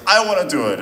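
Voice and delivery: put-on high, loud jealous kid's protest; jealous child. Young actress jealous of her body double, high loud mimicked whine. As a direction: mimic a jealous little girl demanding her turn. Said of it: "like, jealous".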